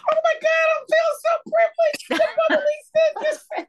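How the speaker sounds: very high, loud squeal with laughing; overjoyed, half crying with laughter; excited shriek. Real, not acted. Thrilled at being named.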